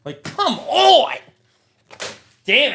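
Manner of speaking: loud, high, drawn-out angry yell; furious shout. Real, not acted. Fed up with bad card pulls, roaring furious shout. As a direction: blow up at the bad pull. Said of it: "I'm getting pissed off now".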